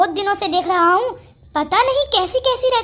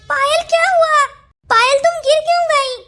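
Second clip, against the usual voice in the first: high, startled cry of concern; panicked calling to a fallen friend; alarmed; 5 semitones higher, 3 dB louder than usual. Acted. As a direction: call out in sudden alarm.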